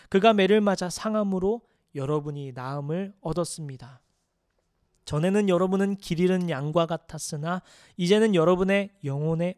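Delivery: loud, measured scripture reading; solemn, projected Bible reading. Acted. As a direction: read the passage out firmly to the congregation. Reads scripture aloud to congregation.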